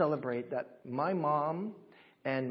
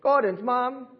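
loud, raised-pitch greeting to a person; greeting demo; 9 semitones higher, 7 dB louder, slower than usual. Shown as a demonstration. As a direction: call it out, high and loud. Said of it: "So you say".

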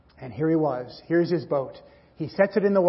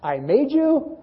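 put-on proud boy; 9 semitones higher, slower than usual. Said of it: "he clutched it to himself and said".

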